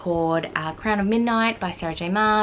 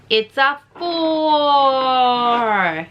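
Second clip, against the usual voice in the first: slow, drawn-out announcement, louder; excited reveal; 6 semitones higher, 6 dB louder, much slower than usual. Real, not acted. Booktuber announcing her dice roll, slow and delighted. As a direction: stretch out the result with glee.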